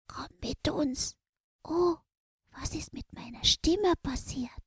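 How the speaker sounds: voiceless whisper, surprised; whisper, puzzled by it; sudden whisper. Acted. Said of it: "wurde seine Stimme plötzlich ganz leise". (überraschtes Flüstern)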